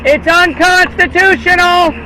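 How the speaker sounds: normal talking voice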